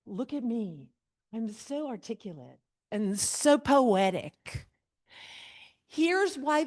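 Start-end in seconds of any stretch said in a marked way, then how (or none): 0.1-4.7 mock vain poet. Teacher mocking the vain narrator to students, preening. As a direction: play the self-admiring narrator. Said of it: "mocking the narrator's vanity".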